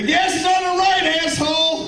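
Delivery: hammed-up angry driver shout; road rage yell. Road rage outburst in car.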